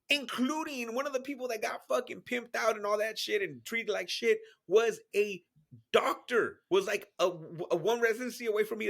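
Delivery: usual speaking voice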